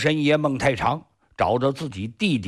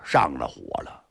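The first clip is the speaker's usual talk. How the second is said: ordinary delivery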